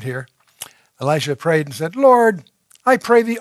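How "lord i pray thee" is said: higher, louder call to the Lord; fervent prayer. Acted. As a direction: Elisha calls out to the Lord in urgent prayer. Elisha prays aloud.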